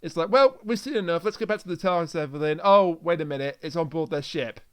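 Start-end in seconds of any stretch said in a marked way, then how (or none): none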